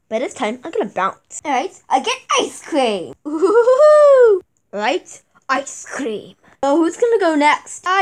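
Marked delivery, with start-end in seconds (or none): 3.3-4.4 loud high hooting laugh; gleeful laugh. Acted. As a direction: whoop with delight. Gleeful laugh at ice cream.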